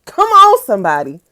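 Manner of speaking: high loud come on; hyped call. Real, not acted. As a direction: call out like at church. Preacher-like call out.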